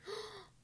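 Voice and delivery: sharp high soft gasp; quick happy intake of breath; soft excited gasp. Real, not acted. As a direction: gasp with delight. Excited about reading a book.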